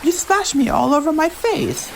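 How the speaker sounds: put-on high, teasing little-girl voice; playful young girl. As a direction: tease your sister after the splash. Elita voiced, splashed.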